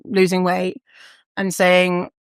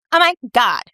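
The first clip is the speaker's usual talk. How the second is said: put-on American accent, higher pitch; 4 semitones higher, 4 dB louder than usual. Formerly fat self judging others, mock American accent. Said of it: "suddenly had an American accent".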